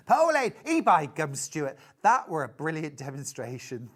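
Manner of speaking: a hammed-up attempt at broad Yorkshire; Yorkshire accent. Teasing a viewer in a put-on Yorkshire accent. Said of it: "Terrib-, terrible accent on my part".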